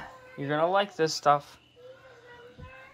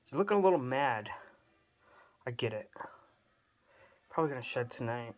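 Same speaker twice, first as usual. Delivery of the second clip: ordinary talk, nothing marked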